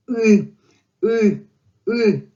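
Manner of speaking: "U" as separate, falling ы grunts; Russian ы demonstration. Shown as a demonstration. Teacher shows learners the transliterated sound, grunting "U" as ы. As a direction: punch out each "U" as a tight Russian ы, not oo. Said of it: "I put this sound".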